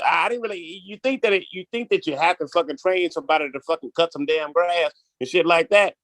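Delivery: usual speaking voice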